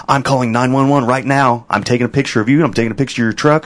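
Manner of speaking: a lightly voiced mock of the threatening driver; mocking the lady. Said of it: "She's like".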